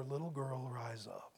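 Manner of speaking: put-on Jesus softly calling the girl to rise; gentle whisper. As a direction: wake her tenderly, barely breathed. Jesus raising the dead girl.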